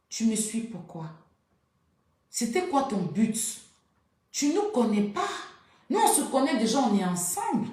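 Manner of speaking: high, loud, indignant telling-off; retold angry confrontation. Narrator replaying how she confronted the woman who followed them, high and loud. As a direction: reenact giving the tagalong woman a piece of your mind.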